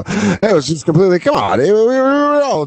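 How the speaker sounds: high, hammed-up defensive whine; mock protesting excuse. Comic parodying excuses for the old scene, mock defensive. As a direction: protest your innocence, pitch climbing sharply.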